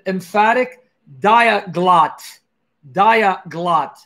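"diaglott" broken slowly into dia and glott; spell-it-out pace on "diaglott"; deliberate, syllable by syllable "diaglott"; slow dictation. Shown as a demonstration. Dictates a word to type.

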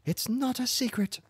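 very high-pitched protest; indignant cry. A narrator's reading. Wife protesting to her husband, high indignant cry. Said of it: "she cried".

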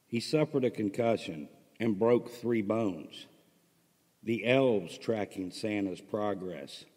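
narrating an absurd Christmas story; story narration. A narrator's reading. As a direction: tell the tale to listeners. Reads his Christmas story aloud.

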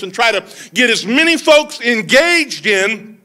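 high-pitched, rousing pulpit delivery; impassioned preaching. Real, not acted. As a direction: drive the point home to the congregation. Preaches at full force.

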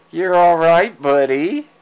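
ordinary talk

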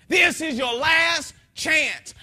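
slow, loud commanding shout; authoritative God voice. Retold or imitated. God warning a man in hell, slow commanding shout. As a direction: thunder it out with full authority. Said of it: "said with so much authority and so much vigor".

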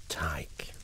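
breathy dramatic whisper. Acted. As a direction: breathe the name as a reveal. Dramatic reveal of his name.

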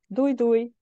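high, loud farewell calls; high, shouted goodbye. Shown as a demonstration. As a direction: sing out goodbye high and loud, like her parents parting. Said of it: "magas hangon kiabálva".

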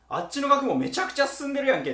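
high, loud amazed exclamation. Retold or imitated. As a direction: gush over how advanced it is. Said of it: "ってなるわけですよ".

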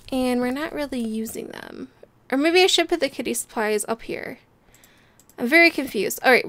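ordinary talk, nothing marked

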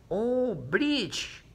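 lightly mocking kid saying the English word; imitating English-speaking kids. Teacher mimicking her English-speaking pupils, lightly mocking. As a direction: tease the kids who say it in English. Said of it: "mis niños de inglés no me van a volver a decir".

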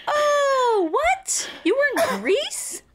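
high, laughing imitation of a surprised mom; hammed-up puzzled mother; mock mom. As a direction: play your confused mom with a laugh. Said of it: "my mom would go".

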